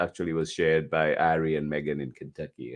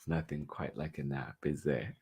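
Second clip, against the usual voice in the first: ordinary delivery, nothing marked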